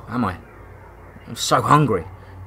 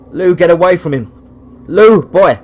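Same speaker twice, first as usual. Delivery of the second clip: loud, high warning shout; calling out; 6 semitones higher, 8 dB louder than usual. Acted. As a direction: yell to pull the dog from the fight. Calling dog away in fight.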